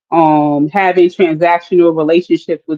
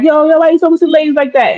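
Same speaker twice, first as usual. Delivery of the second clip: quick, higher imitation of an objecting man; mock challenge; 8 semitones higher, faster than usual. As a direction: rattle it off high, as if stepping in to defend her. Acts man defending her.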